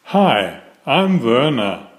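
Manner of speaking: usual speaking voice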